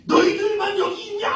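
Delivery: high, loud scolding shouts; acted angry mob. Crowd turning on two blind men, acted angry shouting. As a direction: bark it like a swarm of annoyed bystanders. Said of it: "수많은 사람들이 막 벌떼처럼 일어나가지고".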